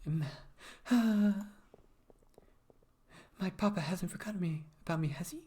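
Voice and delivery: nervous noblewoman asking about her father; anxious young lady. Acted. Voices young Dame Caroline.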